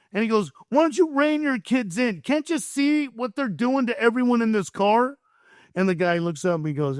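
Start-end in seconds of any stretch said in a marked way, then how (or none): 0.7-5.2 high-pitched imitated telling-off; worked-up, high mock reprimand; angry scolding. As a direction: act out an exasperated stranger confronting someone. Voices angry passenger scolding father.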